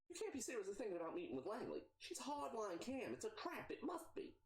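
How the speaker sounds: high, urgent warning voice; Mia protesting. Acted. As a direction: plead with the boss that it's a trap.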